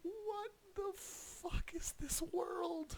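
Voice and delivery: high, slow, drawn-out disbelief; stunned disbelief. Real, not acted. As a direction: stretch every word out in shock. Disbelief at a headline.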